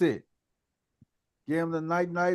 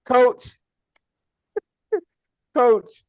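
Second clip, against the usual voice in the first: laughing call; 7 semitones higher, 6 dB louder than usual. Real, not acted. Host calls out laughing.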